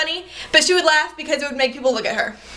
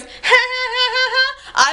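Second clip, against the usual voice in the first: high, fake staccato "ha ha ha"; exaggerated high-pitched mock laughter; imitated mocking laugh; 11 semitones higher, slower than usual. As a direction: mimic an obnoxious forced laugh, pitched way up. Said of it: "one of these girls just goes".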